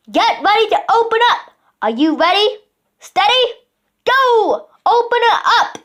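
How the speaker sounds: high, loud commanding count; commanding countdown. A narrator's reading. Duke signalling the pelican to open, high loud commands. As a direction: call the countdown like an order.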